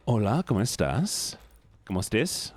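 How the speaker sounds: ordinary talk, nothing marked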